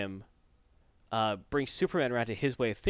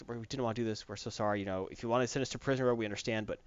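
much softer pleading sorry; hushed remorseful plea; soft apology; 7 dB quieter, faster than usual. Retold or imitated. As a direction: beg forgiveness quietly. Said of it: "they apologize".

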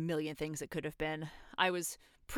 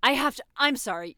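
panicked; 6 semitones higher, 8 dB louder than usual. Acted. Panics, needs to hang up.

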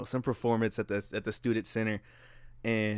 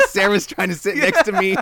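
put-on girl's voice; 7 semitones higher, 8 dB louder than usual.

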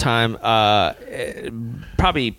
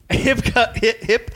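mock hiccup sounds, a bit higher; imitated hiccups; 5 semitones higher than usual. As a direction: hiccup twice as if it's happening. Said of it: "if I start hiccuping".

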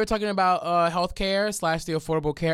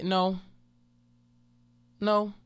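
firm no; 4 semitones higher than usual. Retold or imitated. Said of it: "I say".

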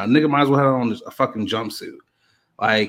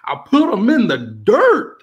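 higher, louder mock yell rising on dirt; imitating a yell; 9 semitones higher, 4 dB louder than usual. Mimicking the rapper boasting onstage, a mock yell. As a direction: shout it like a boastful taunt. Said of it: "And he yelled at".

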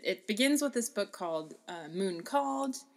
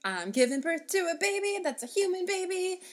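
high mother voice; 10 semitones higher than usual. Retold or imitated. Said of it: "she was like".

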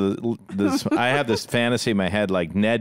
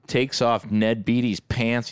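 ordinary delivery

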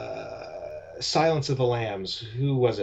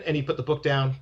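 ordinary talk, nothing marked

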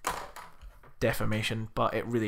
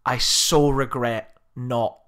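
ordinary talk

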